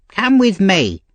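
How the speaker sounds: high-pitched commanding lady; bossy mistress. A narrator's reading. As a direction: order the boy along as his mistress.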